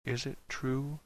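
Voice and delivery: very soft, hushed question; almost a whisper. A narrator's reading. Dan asks one cast member, almost whispering. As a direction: ask it barely above a whisper. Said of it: "so low it was almost a whisper".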